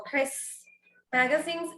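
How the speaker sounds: "Chris Magazines" con la s de Chris sin sílaba extra; "Chris Magazines" dicho como si no hubiera apóstrofe; pronunciación de Chris Magazines. Shown as a demonstration. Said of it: "si lo pronuncio en realidad sería como". (el apóstrofe no se pronuncia en "Chris Magazines")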